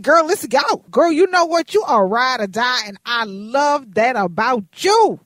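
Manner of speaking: put-on loud, high hyped girlfriend voice; hyped agreement. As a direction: cheer her friend on. Coworker enthusiastically agreeing.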